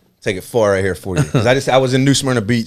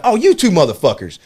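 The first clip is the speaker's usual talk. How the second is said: higher-pitched playful confrontation; mock indignant call-out; 7 semitones higher, 3 dB louder than usual. Comic to two hecklers in the crowd, mock indignant. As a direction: call out troublemakers with a rising, teasing jab.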